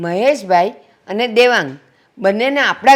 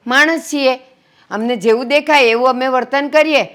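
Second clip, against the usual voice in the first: higher, insistent scolding; heated reproach; 4 semitones higher, 4 dB louder than usual. Acted. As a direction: plead their case with raised, emphatic voice. Woman rebukes angry guest in quarrel. (ઠપકાભર્યો, ભારપૂર્વકનો અવાજ)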